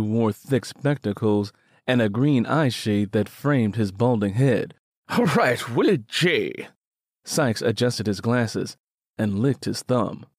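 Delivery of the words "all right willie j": lightly voiced old clerk, higher; a hint of a fussy old paymaster. Old Sykes calling the next worker to be paid, lightly voiced.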